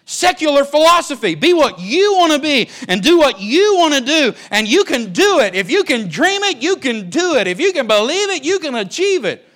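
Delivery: loud, high preaching of self-help slogans; mocking preached slogans. Preacher mocking humanistic slogans to congregation, full-force preaching. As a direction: belt out the worldly creed with scorn.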